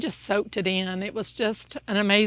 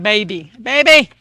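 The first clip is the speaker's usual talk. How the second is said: hollered call; 12 dB louder than usual. Retold or imitated. Jerry calling for his wife Judith, hammed-up holler. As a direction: call out "Baby, baby" across the house. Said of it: "he always hollered".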